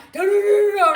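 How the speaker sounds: loud babbling da da da; mock cop shouting. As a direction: mimic cops barking at her. Cops rushing up.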